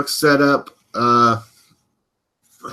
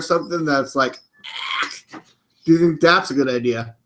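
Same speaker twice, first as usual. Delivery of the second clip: normal talking voice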